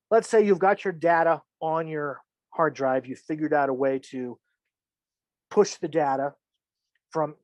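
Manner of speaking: ordinary talk, nothing marked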